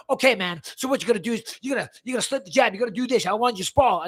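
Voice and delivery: imitating an over-eager coach; pushy cornerman. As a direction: rattle off corner orders in a put-on coach voice. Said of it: "some guy's going".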